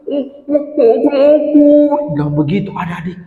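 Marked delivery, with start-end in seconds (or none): 0.1-1.7 put-on high, stuck stammer of a guilty kid. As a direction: stumble over words, caught out. Tutu stammers, caught. (tergagap ketakutan)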